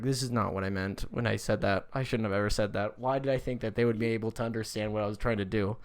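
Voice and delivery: low, quiet mock regret; Jesus voiced. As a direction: sigh it as a disappointed Jesus. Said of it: "Jesus is in heaven ... like".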